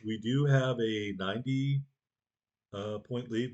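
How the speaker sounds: ordinary talk, nothing marked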